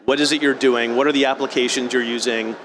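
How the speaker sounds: ordinary delivery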